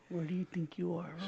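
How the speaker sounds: low, very soft voice; hushed movie quote. Acted. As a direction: say it gently and low, teasing him toward tears. Quoting the sad episode to friend.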